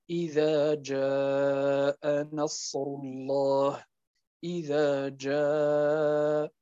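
جاء recited slowly with its madd held four beats; slow Quranic recitation stretching جاء; lengthened madd. Shown as a demonstration. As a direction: draw out the long vowel in جاء. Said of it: "واضح؟".

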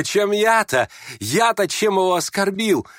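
high, protesting question; insisting. Acted. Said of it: "настаивал Ипполит". (настойчиво, с возмущением)

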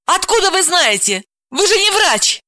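very high, sceptical female voice; incredulous woman, pitch climbing; indignant challenge. Acted. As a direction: challenge him crossly. Heroine arguing with the panicking man.

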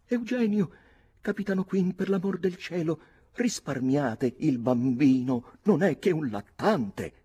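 put-on high, loud pleading young lady; terrified cry. A narrator's reading. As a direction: beg frantically for mercy, drawing out the plea. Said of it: "sconvolta dal terrore, l'abbracciò gridando".

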